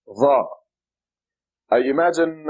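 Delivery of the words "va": Va with the tongue between the teeth; heavy tongue-out Z in Va; tongue sticking out. Shown as a demonstration. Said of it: "sticking your tongue out".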